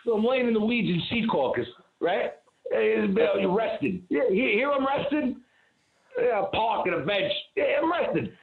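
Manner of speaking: lightly put-on high voice; high-pitched mock protest; acted bit character. Acting out a remembered comedy bit.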